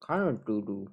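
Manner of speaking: talking through a mouthful; cheeks stuffed full. Real, not acted. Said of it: "I gotta stop talking with my mouth full".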